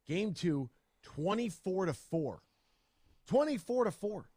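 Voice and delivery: can't believe the score; incredulous. Real, not acted. Stunned at blowout score.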